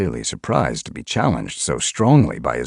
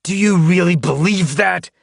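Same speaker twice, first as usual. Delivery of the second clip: much higher, louder scornful challenge; snarl; 8 dB louder than usual. A narrator's reading. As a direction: snarl it in her face. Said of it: "he snarled".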